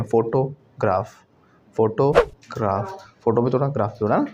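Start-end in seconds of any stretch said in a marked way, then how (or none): 0.0-3.0 slow "फोटोग्राफ" split as FO-TO, GRAAF; "फोटोग्राफ" broken into foto and graaf; old mispronunciation. Shown as a demonstration. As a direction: say "फोटोग्राफ" in pieces with a long AA in graaf. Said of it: "पढ़ते हैं क्या बोलते हैं".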